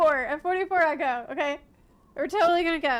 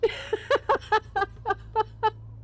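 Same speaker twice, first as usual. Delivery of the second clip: shrieking jackpot winner; 8 semitones higher than usual. Real, not acted. Nervous laugh after admitting cowardice.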